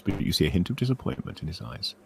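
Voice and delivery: ordinary delivery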